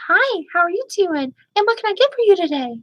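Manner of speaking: high, sing-song fake cheer for a customer; a server's bright put-on customer voice; fake polite server voice. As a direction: chirp it like an overly polite waitress. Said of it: "someone has an extremely fake voice".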